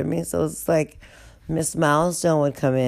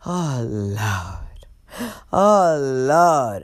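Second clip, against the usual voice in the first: put-on old woman's drawn-out winded groan; out of breath; 2 semitones lower, much slower than usual. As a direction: heave it out, stretched and winded. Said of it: "she is out of breath".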